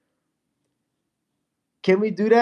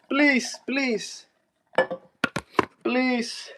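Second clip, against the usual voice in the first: mock pleading; 8 semitones higher, much slower than usual. Teasing a friend into joining the stream with high mock pleading. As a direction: beg like a whiny kid, clearly joking.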